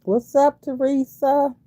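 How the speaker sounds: high, loud hello; hearty welcome to the chat; cheerful greeting. Real, not acted. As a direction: call out to a new viewer.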